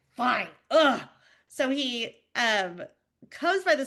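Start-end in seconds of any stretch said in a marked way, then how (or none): 0.1-1.1 curt fine, then a loud groan; grudging sigh. Retold or imitated. Husband giving in, with a loud groan. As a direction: give in with an exasperated ugh. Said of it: "he's like".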